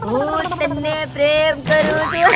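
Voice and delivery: very slow, stretched-out words; lovey-dovey, dragged out slowly; drawn-out love declaration. Acted. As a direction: draw out each word of a mock love message. Reads out love message teasingly.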